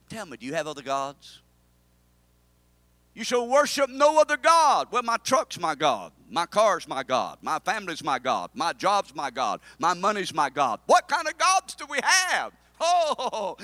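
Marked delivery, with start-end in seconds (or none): 3.2-13.6 preaching at full force. Acted. Preacher to congregation, loud fervent preaching.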